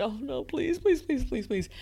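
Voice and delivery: high-pitched, repeated begging; desperate pleading. Retold or imitated. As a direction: plead in a small high voice, reliving it. Retelling her desperate inner plea.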